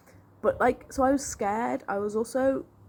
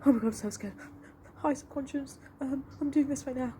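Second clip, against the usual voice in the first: put-on higher, timid little-kid voice; a lightly voiced scared child saying hi to her subconscious; 8 semitones higher, 3 dB quieter than usual. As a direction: play it like a frightened small child greeting the dark. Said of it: "I was like a little child".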